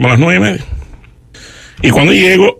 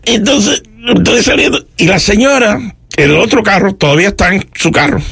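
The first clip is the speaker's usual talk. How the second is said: ordinary talk, nothing marked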